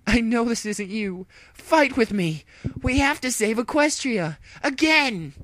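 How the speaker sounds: high, very loud, pleading calls; hero's desperate cry. Acted. Dash to brainwashed friend, loud desperate plea. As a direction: shout to wake your friend.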